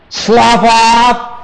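ordinary delivery

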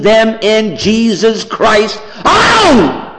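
high-pitched, impassioned sermon at a near yell; full-force preaching. Real, not acted. Preacher warning the church that no church can save, full-force preaching. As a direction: cry it out to the congregation, pitch climbing far up.

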